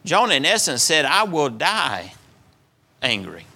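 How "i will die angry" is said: slow grim vow. Acted. Jonah's stubborn vow, drawn out.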